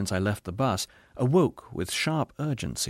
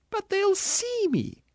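very high, drawn-out wail; panicked ghost; slower than usual. A narrator's reading. Ghost dreading being seen, panicked wail.

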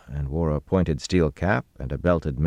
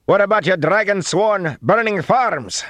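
high, loud, alarmed yell; frightened shout; 6 dB louder than usual. A narrator's reading. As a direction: shout it in fear from a distance. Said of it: "a man's frightened voice shouted".

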